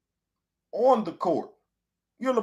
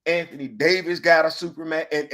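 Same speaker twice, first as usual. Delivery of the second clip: ordinary talk, nothing marked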